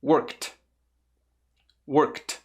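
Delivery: "Worked" as one syllable ending in a separate, crisp "kt"; exaggerated final T. Shown as a demonstration. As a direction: overdo the T sound at the end of "Worked". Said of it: "Eu tô exagerando um pouco". ("Worked" com o som de T exagerado no final)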